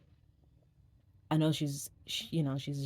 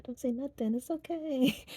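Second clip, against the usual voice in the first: mock bashful reply; 11 semitones higher than usual. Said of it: "but it's like".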